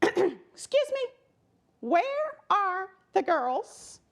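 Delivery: put-on high, slow little girl voice; a sassy kid calling out the teacher; hammed-up outraged little girl; indignant girl. A narrator's reading. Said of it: "could not believe her eyes".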